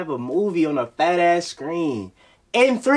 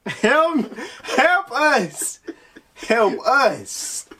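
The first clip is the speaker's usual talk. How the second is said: mock plea; 8 semitones higher, 4 dB louder, much slower than usual. Joins mock plea for help.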